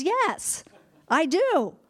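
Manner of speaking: high, loud defiant retort; mad Jonah. Acted. Jonah answers God's question, hammed-up angry defiance. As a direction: snap back indignantly, acting Jonah. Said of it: "he's mad again because it".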